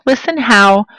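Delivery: ordinary talk, nothing marked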